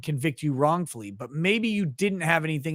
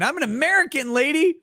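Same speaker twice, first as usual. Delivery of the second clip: much higher, huffy retort; mock indignant; 11 semitones higher, 3 dB louder than usual. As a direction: play the offended American. Retort to a Canadian viewer.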